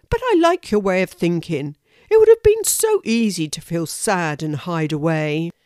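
a friendly hedgehog offering support; approving hedgehog. Acted. Horace praises the squirrel's thinking, lightly voiced and approving.

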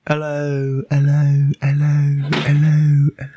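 repeating hello like a fading echo; mock echo effect. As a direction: play your own echo. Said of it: "my echo".

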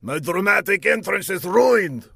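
high, sulky villain voice; high petulant villain grumble; sulking villain. A narrator's reading. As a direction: pout about the ruined entrance. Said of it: "ruminated the figure".

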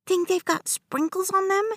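put-on excited little boy. A narrator's reading. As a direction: ask it like a kid hopping in place. Child excited, jumping.

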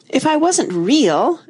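high, slow protest with a touch of tears; mildly tearful, almost amused; half laughing through tears. A narrator's reading. Alice argues back to the twins, half laughing through tears. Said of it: "half laughing through her tears".

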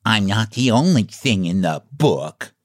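mildly cross clown voice. A narrator's reading. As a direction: grumble lightly as an offended clown. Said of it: "he remarked crossly".

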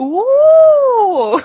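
sustained high, loud "ooh"; hammed-up excited whoop; excited Minnesotan ooh. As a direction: mimic the family's big drawn-out ooh. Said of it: "something that excites my family".

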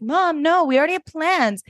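high protesting daughter; high, quick refusal; whiny Lorelai. Retold or imitated. Lorelai refusing her mother, high and whiny. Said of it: "And Lorelai's like".

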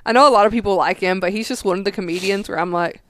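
ordinary delivery

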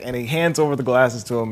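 usual speaking voice

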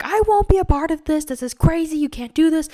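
angry shouting; yelling. Retold or imitated. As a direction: yell it at your parents. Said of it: "yelling at them in the dream".

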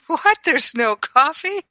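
high startled what, light and quick; surprised. Shown as a demonstration. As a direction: sound genuinely caught off guard, not upset. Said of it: "rather than just was surprised".

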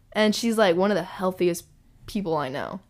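ordinary delivery

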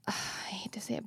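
sigh, then whisper it reluctantly. Real, not acted. Guest admitting an awkward truth in a reluctant whisper. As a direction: drop to a hush, half-embarrassed to admit it. Reluctant aside mid-story.

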